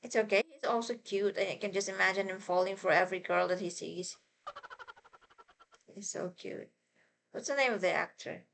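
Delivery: ordinary delivery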